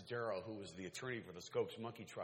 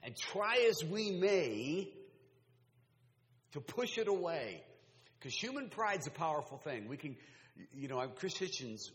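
full force preaching; 5 semitones higher, 8 dB louder than usual. Real, not acted. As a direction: build and drive the point home.

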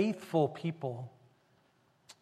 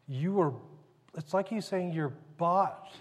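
ordinary talk

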